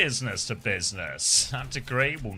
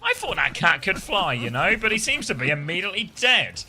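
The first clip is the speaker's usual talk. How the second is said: lively play-by-play, rising with the action; esports caster; 6 semitones higher, 5 dB louder than usual. Acted. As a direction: call the match like a live caster. Casting the match live.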